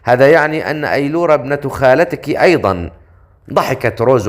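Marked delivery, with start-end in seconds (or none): none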